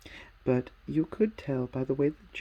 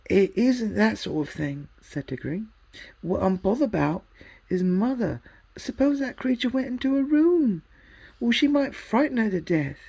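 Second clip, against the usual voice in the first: higher, louder, anxious; worried boy; 8 semitones higher, 6 dB louder than usual. A narrator's reading. Diggory explaining fear for his mother, higher louder.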